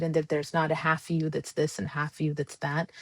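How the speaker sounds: ordinary delivery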